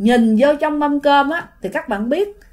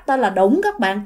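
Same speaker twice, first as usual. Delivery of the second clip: plain reading voice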